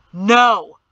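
loud, forceful shout of refusal; thundering no. A narrator's reading. Father thunders at his son, loud. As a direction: thunder the word out. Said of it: "Perrin thundered".

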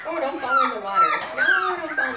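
loud, urgent call to the puppy; loud warning. Real, not acted. Puppy near water dish.

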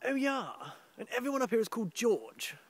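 high-pitched mock of affected incomers; stupid put-on voice. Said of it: "they put on this stupid voice".

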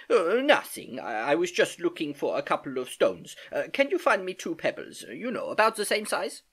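put-on hesitant older relative with halting starts; lightly voiced flustered uncle. Uncle asking niece to find pebbles, distracted.

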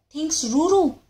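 plain reading voice

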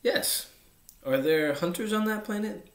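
lightly intrigued questioning; a touch of eager interest. A narrator's reading. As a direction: pitch lifting with curiosity as the questions come. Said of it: "The fox seemed quite intrigued".

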